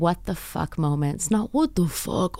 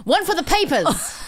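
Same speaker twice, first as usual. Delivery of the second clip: 1940s cameraman; 10 semitones higher than usual. Acted. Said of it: "nineteen forties cameraman".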